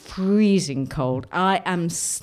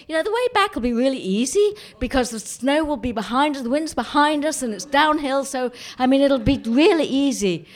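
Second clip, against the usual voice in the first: Graham; 6 semitones higher than usual. Retold or imitated. Graham cheerfully reassuring her.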